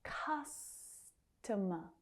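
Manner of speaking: Customer broken up slowly, first syllable held; soft, slow Customer with reduced last two syllables; stress then schwas. Shown as a demonstration. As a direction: say Customer as CUSS, pause, tuh-muh. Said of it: "reduced down to the schwa".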